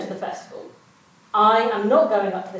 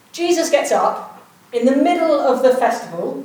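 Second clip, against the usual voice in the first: ordinary talk, nothing marked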